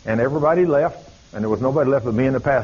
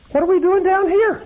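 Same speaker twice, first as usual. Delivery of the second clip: bewildered; 3 dB louder than usual. Retold or imitated. Woman waking after deliverance.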